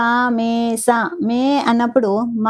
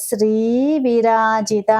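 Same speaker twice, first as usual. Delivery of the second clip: the whole name drawn out, ధర lengthened at the end; slow careful recitation; much slower than usual. Shown as a demonstration. Teacher modelling the full name for students, slow. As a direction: recite it slowly, stretching the last syllable. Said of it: "slow recitation demo".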